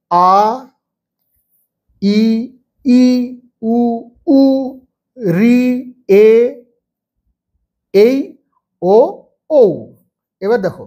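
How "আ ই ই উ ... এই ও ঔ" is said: each vowel sound said clearly and separately, with pauses between; teacher shows pupils each vowel sound one by one; vowel sounds drill. Shown as a demonstration. Teacher sounding out each vowel for pupils. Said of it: "তাহলে এগুলো প্রথমে দেখো".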